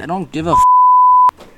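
high, loud stretched yelp; shrieking. Real, not acted. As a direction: cut off mid-shout in pain. Stabbed during game, yelps.

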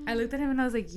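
usual speaking voice

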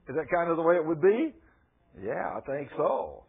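normal talking voice

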